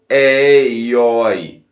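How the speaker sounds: "é e oi" slow, drawn out, with open vowels; open diphthongs. Shown as a demonstration. Teacher shows students the open diphthongs, "é e oi" said slowly. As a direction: draw out the open sounds in "é e oi". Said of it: "ditongos abertos".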